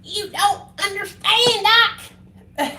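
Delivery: high, slow, drawn-out plea; pleading. Retold or imitated. Telling Doctor B he doesn't understand, high drawn plea. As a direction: imitate herself begging her doctor, high and slow. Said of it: "I said".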